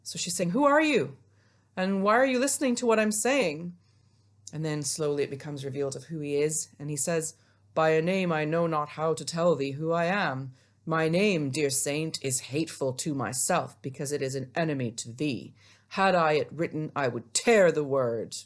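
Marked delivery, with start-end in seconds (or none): 0.5-3.7 high, startled questioning; surprised. Acted. Teacher paraphrasing Juliet to the hidden stranger, acted surprise. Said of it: "she's a bit surprised by his visit". 7.7-18.4 lowered voice of a dramatic lover; Romeo. Acted. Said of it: "my best Romeo voice".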